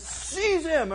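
high-pitched mock royal order; acted command. Jeroboam ordering the prophet seized, acted command.

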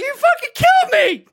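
very high, loud panicked accusation; acted-out hysterical yell; freaked-out scream. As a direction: scream it in over-the-top panic. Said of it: "I'm fucking freaking out".

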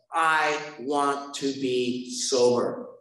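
very slow, word by word; resolute vow. Retold or imitated. Man telling himself his turning-point decision, slow resolute vow. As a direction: give each word its own weight, slowly.

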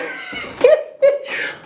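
airy squeaking laughter; high breathy laugh. Real, not acted. Laughing at the coincidence.

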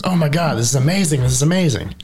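overjoyed townsfolk, hammed up; mock townsfolk delight. Storyteller voicing relieved villagers, mock delight.

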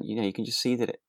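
ordinary delivery

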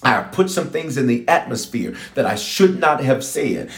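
ordinary talk

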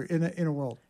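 soft trailer voice, lightly done; hint at the trailer narrator; movie trailer voice. Retold or imitated. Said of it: "do the movie trailer".

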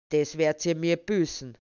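loud, forceful threat; thundered warning; vengeful anger. A narrator's reading. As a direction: swear revenge, punching each word. Thwarted man vows revenge.

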